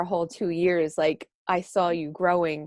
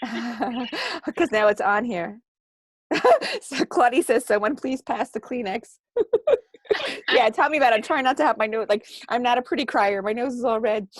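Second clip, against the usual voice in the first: teary laughter; 6 semitones higher, 5 dB louder than usual. Real, not acted. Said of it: "I'm gonna cry again".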